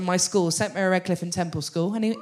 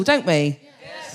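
ordinary talk, nothing marked

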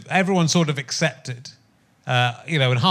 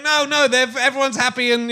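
higher, eager mock insistence; imitating eager protest; 8 semitones higher than usual. Host retells the character protesting to the liberals, higher mock voice. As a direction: chirp it out like the cheerful loyal character. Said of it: "And she's going".

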